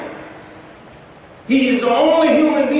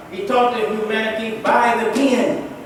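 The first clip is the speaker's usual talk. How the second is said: ordinary talk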